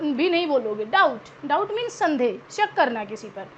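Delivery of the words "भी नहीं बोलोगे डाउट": usual speaking voice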